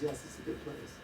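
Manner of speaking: whispered teasing echo of a simple phrase; whispering his wife's simple sentence to tease her; whispered imitation. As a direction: breathe it out as a mock whisper. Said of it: "the simplicity of it is".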